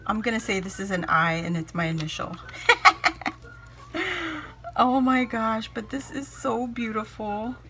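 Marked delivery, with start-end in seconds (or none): 2.4-4.7 high, helpless giggling; long delighted cackle; big laugh. Real, not acted. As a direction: burst out laughing. Laughing at her own initial remark.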